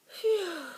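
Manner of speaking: high, airy gasp; relieved gasp. A narrator's reading. Mouse relieved the dog has left, gasping. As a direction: gasp it out as danger passes. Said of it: "gasped Ralph".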